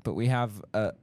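ordinary talk, nothing marked

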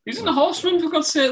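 high, loud, indignant protest; indignant outburst. Real, not acted. Defending Mongo to friends, indignant and loud. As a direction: throw it out like an outraged fan.

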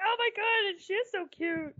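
gushing. Retold or imitated. She gushes over the panda.

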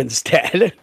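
normal talking voice

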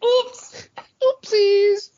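high, cutesy oopsie voice; silly high voice. Pretend. Joining the joke with a high cutesy oopsie. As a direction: squeak it out like a guilty toddler. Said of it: "we're getting silly".

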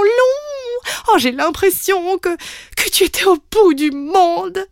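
high, loud emotional gushing; gushing mother. Acted. Mother hugs her daughter back from the farm, gushing. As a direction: gush over her like a teary mum.

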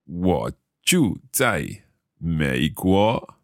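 normal talking voice

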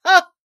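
loud scornful laugh-shout; a contemptuous ha. A narrator's reading. Little John scoffing at the cook, loud ha. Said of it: "cried Little John".

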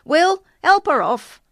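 loud, high-pitched dad's shout. A narrator's reading. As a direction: shout instructions across to your son. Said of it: "his dad shouted".

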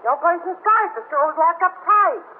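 exasperated protest, pitch climbing high; indignant outburst. Acted. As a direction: fire it back at him, fed up. Angry customer protests to the officer.

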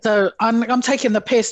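ordinary talk

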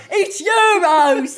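slow, loud, drawn-out correction; crowd correcting again. Retold or imitated. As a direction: drag out the correction loudly. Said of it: "they kept going".

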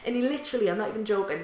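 ordinary talk

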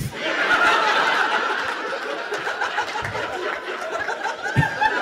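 airy wheezing laughter; breathless silent laugh at the punchline; long helpless laugh. Real, not acted. Friend laughs at own road rage story, wheezing laughter.

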